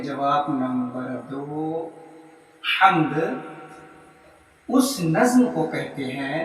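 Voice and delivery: slow, soft word-by-word dictation with pauses. Shown as a demonstration. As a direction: dictate each phrase for students to write down. Dictates second answer. (धीमे-धीमे, रुक-रुक कर लिखवाना)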